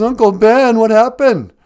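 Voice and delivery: high, loud panicked call; alarmed cry. Acted. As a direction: cry out in shock over a wounded uncle. Finds uncle shot.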